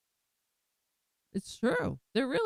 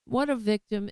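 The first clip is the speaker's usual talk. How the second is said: usual speaking voice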